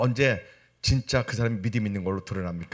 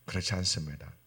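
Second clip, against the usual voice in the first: normal talking voice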